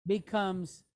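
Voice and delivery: ordinary delivery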